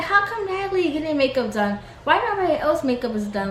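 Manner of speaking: high-pitched, put-out complaint; mock upset. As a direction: imitate someone upset at being left out. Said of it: "she's clearly upset".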